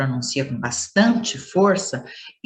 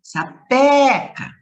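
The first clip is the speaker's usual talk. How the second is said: "Sapeca" with a long, loud, high PE; exaggerated stress on PE; 8 semitones higher, 6 dB louder, much slower than usual. Shown as a demonstration. Teacher repeating for pupils, heavily stressed PE in "Sapeca". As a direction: stretch and belt the PE of "Sapeca". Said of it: "eu pronunciei o pé com mais forte".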